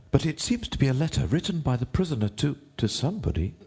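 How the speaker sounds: high, deferential courtier. A narrator's reading. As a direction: address the king in a high rabbit voice.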